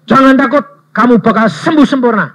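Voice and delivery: loud, high assured promise; bold proclamation. Acted. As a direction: proclaim healing with full confidence. Preacher proclaims healing. (seruan yakin dan keras)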